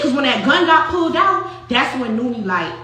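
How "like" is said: ordinary delivery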